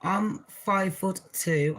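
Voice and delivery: usual speaking voice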